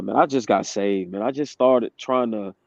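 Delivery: normal talking voice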